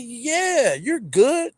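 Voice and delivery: a hint of a chirpy salesman; dealer voice.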